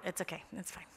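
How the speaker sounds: a low, easy aside to the room; quiet reassuring aside. Real, not acted. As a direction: wave it away softly. Reassuring after the phone-number joke.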